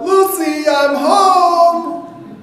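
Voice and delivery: high, loud, sung-out greeting; sing-song call home. Acted. Healed man calls out to his wife at the door, sing-song. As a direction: call it out like a sitcom entrance.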